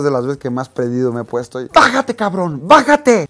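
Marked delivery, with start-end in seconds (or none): 1.7-3.3 furious yelling. Retold or imitated. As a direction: bark the order at the driver, building up. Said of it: "súper enojado". (le grita furioso al valet que se baje del coche)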